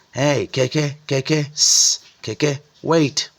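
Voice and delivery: turbaned man hailing the keke from the street, loud; hailing a ride. Acted. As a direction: flag down the rickshaw, calling. Man hails the rickshaw.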